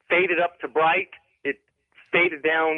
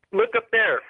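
higher-pitched, mild yell to a buddy; retold call-out; 6 semitones higher than usual. Caller retells alerting his buddy to a light, mild yell. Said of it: "I yelled over to my buddy".

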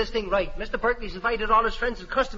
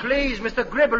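ordinary talk

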